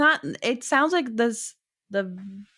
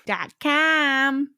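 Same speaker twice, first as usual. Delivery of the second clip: higher, put-on Boston accent; 4 semitones higher, 4 dB louder than usual. Host repeating the web address to co-host, Boston accent. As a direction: hit it in a mock Boston accent. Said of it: "a Boston accent, I guess".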